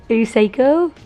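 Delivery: high loud teasing pet talk. Real, not acted. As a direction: tease the wild cat, squealing. Cat going crazy, she teases.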